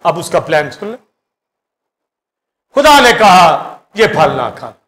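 ordinary delivery

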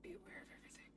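hushed whisper. Real, not acted. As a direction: whisper it under your breath. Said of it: "Why am I whispering?".

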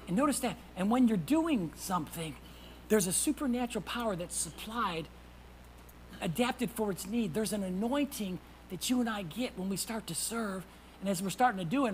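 usual speaking voice

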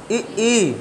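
ई, ई said with the tongue front pressed back; vowel demo. Shown as a demonstration. Teacher showing students how to say ई. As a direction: hold the tongue and sound ई, ई clearly. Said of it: "बोलो, जीभ रोक के बोलो".

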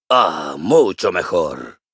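deep, low sigh of satisfaction; relieved. Acted. Dwarf finally comfortable after waiting, deep relieved groan. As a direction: let it out with great relief.

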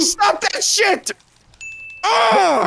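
panicked yell. Real, not acted. Panicked reaction to attack.

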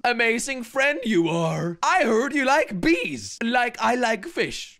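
slow, drawn-out imitation accent, word by word; Crainer accent. Reading a friend's sign aloud in a slow mock Crainer accent. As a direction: put on a friend's accent, stretching each word. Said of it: "read this with a Crainer accent".

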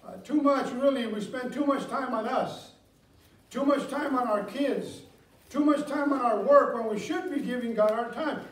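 loud, driving pulpit delivery; full-force preaching. Acted. Pastor pressing the church to give time, loud preaching. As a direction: preach it hard to the pews.

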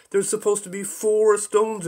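loud, high angry shout. Acted. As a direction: yell it at the captain. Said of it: "he shouted".

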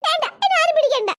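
high, whiny crying protest; tearful sulking. Acted. Scolded child refusing comfort, tearful protest. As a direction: push everyone away through tears. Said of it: "നിന്റെ കരച്ചിൽ കേട്ടിട്ട്".

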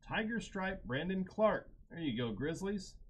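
high-pitched, hyped hit call; excited card reveal. Real, not acted. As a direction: announce a big pull with rising excitement. Announcing a rare card pull.

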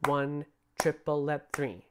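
"One, triple, let three" slow and evenly spaced; careful even counting. Shown as a demonstration. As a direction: count it slowly, each syllable equal. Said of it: "They have to be even".

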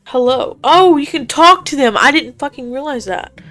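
ordinary talk

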